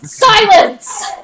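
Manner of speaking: booming shout of silence; yelled command. Acted. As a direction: roar it at the room. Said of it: "It yells".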